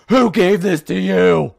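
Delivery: loud, slow, drawn-out demand; mock horror. A dad finds a business plan in his daughter's bag and demands answers, loud and slow in comic alarm.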